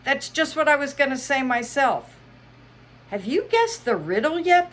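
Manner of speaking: high, excitable Hatter; Mad Hatter voice. Acted. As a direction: play the Hatter high and bright. Hatter voiced in read-aloud story.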